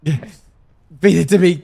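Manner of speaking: slow, strained and fading; weak, dying plea. Acted. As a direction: beg for food weakly. Weak wounded man begging.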